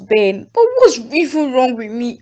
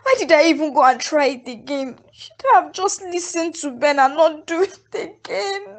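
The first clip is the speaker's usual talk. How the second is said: crying; 9 semitones higher than usual. Acted. Mother weeps after failed IVF.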